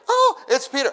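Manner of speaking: high, loud cry of joy; excited announcing. Retold or imitated. Rhoda telling the church Peter has come, excited. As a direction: burst in with the news.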